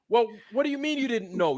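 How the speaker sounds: ordinary talk, nothing marked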